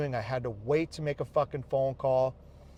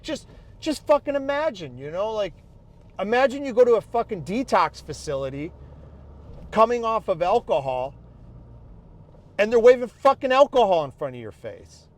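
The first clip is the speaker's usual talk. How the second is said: rising outrage, higher and louder toward the end; angry rant; 5 semitones higher, 4 dB louder than usual. Real, not acted. Venting to viewers about the detox doctor, building to loud anger.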